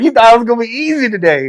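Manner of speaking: high mocking taunt. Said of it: "They're like".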